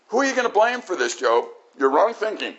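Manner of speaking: normal talking voice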